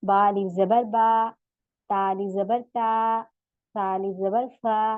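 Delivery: बा, ता, सा stretched for two counts, slowly; slow Quran-reading drill; slow recitation drill. Shown as a demonstration. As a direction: spell it out and draw out each vowel. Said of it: "सिर्फ हमारा अलिफ मद है".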